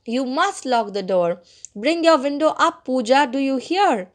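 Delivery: plain reading voice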